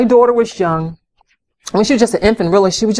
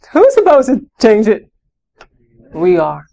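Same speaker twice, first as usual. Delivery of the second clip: ordinary talk, nothing marked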